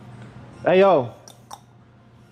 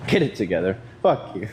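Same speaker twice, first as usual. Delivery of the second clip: ordinary talk, nothing marked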